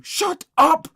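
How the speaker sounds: high, very loud yell; angry shout. A narrator's reading. Boys silencing screaming friend, shouting. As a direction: shout him down hard. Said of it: "shouted Henry and Ruth".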